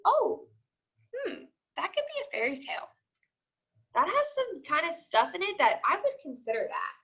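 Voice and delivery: lightly voiced curious student thinking it over; musing pupil. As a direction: play a kid figuring it out aloud. Teacher voices a pupil's thought.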